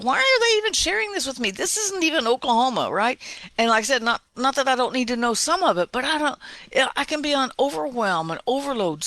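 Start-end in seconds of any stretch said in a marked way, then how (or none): 0.0-2.9 much higher, fast, incredulous; mock exasperation at the news feed; exasperated inner voice. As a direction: squeak it out in disbelief. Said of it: "I'm like".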